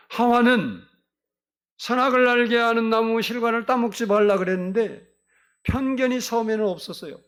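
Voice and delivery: loud, high preaching voice; forceful preaching. Real, not acted. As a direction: drive the point home from the pulpit.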